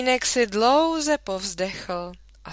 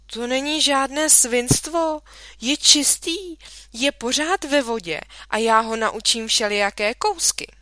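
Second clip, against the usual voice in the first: put-on pleading child; 4 semitones higher, 5 dB louder than usual. A narrator's reading. Boy protests to mother.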